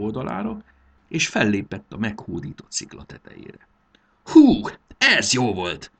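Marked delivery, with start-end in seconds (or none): 4.2-5.9 loud, slow cry of relief; a boy's loud, slow whoop after crossing the bridge; triumphant exclamation. A narrator's reading. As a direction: shout out your relief at making it across. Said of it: "kiáltotta".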